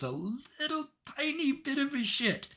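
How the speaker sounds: very high, stretched squeak on the smallness; tiny squeezed voice. Real, not acted. Game master praising Phoebe's spark of caring, squeaky emphasis. Shows how little she cares.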